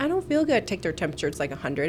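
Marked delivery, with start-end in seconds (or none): 0.0-0.7 put-on high whining child voice; lightly voiced whiny child; whiny kid. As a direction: complain like a sick kid. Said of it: "they're like".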